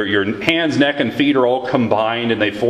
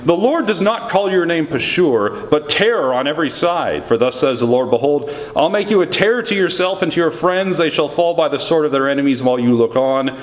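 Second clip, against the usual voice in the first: raised, declaiming scripture reading voice; 4 semitones higher than usual. Acted. Pastor reads Jeremiah's words to Pashur, declaiming. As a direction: proclaim the prophecy from the pulpit.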